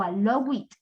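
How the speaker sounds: "allow it" in place of allowed, with an added vowel; wrong extra syllable. Shown as a demonstration. Teacher showing another mistake to avoid, wrong extra syllable. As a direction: end on a separate "it" syllable in "allow it". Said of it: "no, no".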